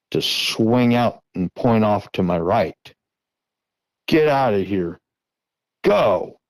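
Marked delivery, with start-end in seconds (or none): 4.1-6.4 retold yelling. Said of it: "I'm yelling this".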